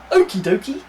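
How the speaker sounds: cheerful guard. Acted.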